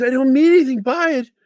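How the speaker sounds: high-pitched backpedaling; imitated kid pleading no offense; retold innocent protest.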